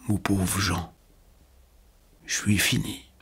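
soft and affectionate, a dying man's hush; tender, unfamiliar accent. A narrator's reading. Dying old keeper to young Jean, softly tender. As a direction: say it gently, as if for the first time. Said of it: "d'un accent que je ne lui avais jamais entendu".

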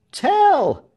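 high, loud cheering shout; crowd shout. A narrator's reading. Crowd acclaiming its hero Tell, shouting. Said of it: "shouted the crowd".

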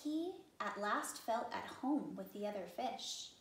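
ordinary delivery, nothing marked

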